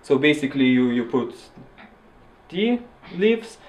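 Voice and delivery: normal talking voice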